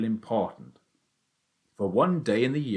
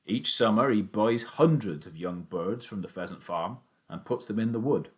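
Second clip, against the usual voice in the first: usual speaking voice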